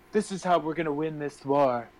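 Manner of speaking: mock sultry love-scene delivery. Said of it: "your sultry voice is real".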